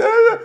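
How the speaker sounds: high loud whiny grunt; child whining. Retold or imitated. Pastor mimicking his whining son, high whiny grunt. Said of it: "coming there whining".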